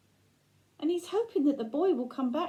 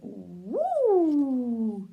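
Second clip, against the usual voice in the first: mock owl "woo" in a put-on voice; owl hoot. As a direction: swoop in with a spooky owl call. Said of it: "he went".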